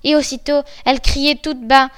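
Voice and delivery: ordinary delivery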